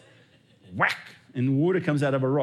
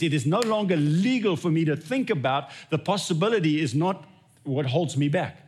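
ordinary talk, nothing marked

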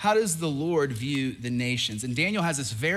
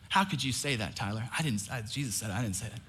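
hushed, softer mock protest; mock defensive aside; 5 semitones lower, 6 dB quieter than usual. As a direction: quietly play innocent, a little defensive. Pastor mock-defends himself, hushed.

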